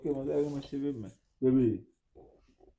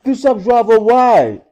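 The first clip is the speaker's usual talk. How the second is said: loud pleading; 8 semitones higher, 11 dB louder than usual. Real, not acted. Boyfriend telling his upset girlfriend it was a prank, loud and pleading. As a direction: insist it was only a prank.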